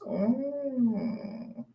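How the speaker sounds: normal talking voice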